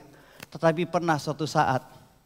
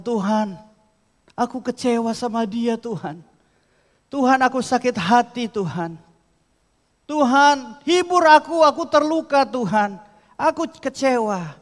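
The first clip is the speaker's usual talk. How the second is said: anguished prayer; 8 semitones higher, 6 dB louder than usual. Acted. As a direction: voice rising to a pained cry to God. Prayer voiced as hurt believer. (doa penuh luka, suara meninggi)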